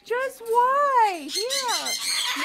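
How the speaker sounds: high, emphatic exasperation; exasperated plea. Real, not acted. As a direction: plead with a maddening pet. Exasperated with bird dropping food.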